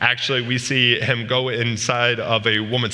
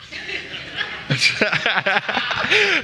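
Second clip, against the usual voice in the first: high laugh; 9 semitones higher than usual. Real, not acted. Teacher laughs at Jamil.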